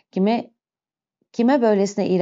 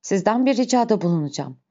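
ordinary delivery, nothing marked

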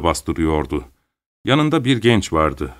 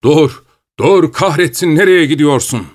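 loud, higher rough yelling; rough shout; 7 semitones higher, 5 dB louder than usual. A narrator's reading. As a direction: bellow after someone running off. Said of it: "Kaba bir ses bağırıyordu". (kaba bir sesle bağırarak)

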